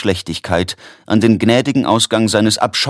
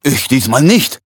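higher, blurted-out protest; unwillkürlicher Ausruf; 5 semitones higher, 4 dB louder than usual. A narrator's reading. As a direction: blurt it out involuntarily. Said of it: "rief Tretebalg unwillkürlich". (unwillkürlich herausgerufen)

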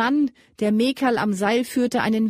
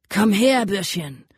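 hissed threat. A narrator's reading. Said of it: "zischte der Vorderste".

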